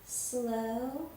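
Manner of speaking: drowsy drawn-out slow. Shown as a demonstration. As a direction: stretch out slow, slow, slow. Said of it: "go slow".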